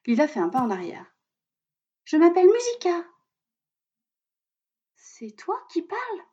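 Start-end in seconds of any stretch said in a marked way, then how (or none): 2.0-3.0 high, bright bird voice; little robin voice. A narrator's reading. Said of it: "lui répond Musica". 4.9-6.2 put-on high, hushed little girl; a startled young girl asking softly. A narrator's reading. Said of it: "demande Lila au rouge-gorge".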